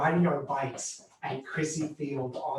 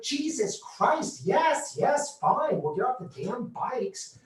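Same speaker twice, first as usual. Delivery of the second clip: higher, drawn-out exasperation; fed-up compliance, pitched up; exasperated retort; 6 semitones higher, slower than usual. Retold or imitated. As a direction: give in with annoyed protest. Retorting to the yelling ranger.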